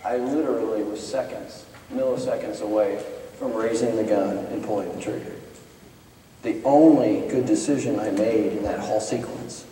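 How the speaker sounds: quiet, sober confession; hushed. Real, not acted. Preacher admitting he nearly fired, quiet and grave. Sober reflection after story.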